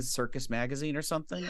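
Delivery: ordinary talk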